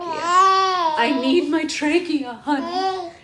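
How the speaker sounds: milk the plea like a tragedy; mock pleading. Mom begging the kicking baby to spare her throat, mock wail.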